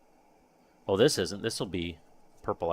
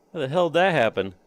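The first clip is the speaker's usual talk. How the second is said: raised, louder voice of disbelief; amazed disbelief; 5 semitones higher, 5 dB louder, faster than usual. Real, not acted. Streamer baffled by the flipped part, amazed disbelief. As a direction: wonder loudly at what just happened.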